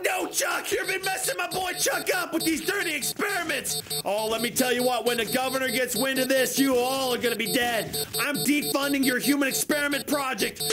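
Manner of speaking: usual speaking voice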